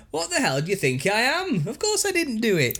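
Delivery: mock outrage in a hammed-up northern English accent. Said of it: "That is not the accent they'll have".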